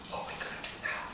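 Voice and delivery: a scared king hushing his fear; frightened whisper. Acted. The frightened king whispering to his soldier. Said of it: "the king was frightened".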